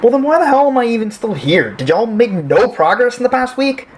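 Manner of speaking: high-pitched, indignant outburst; exasperated, high-voiced protest; worked up. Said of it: "you need to calm down".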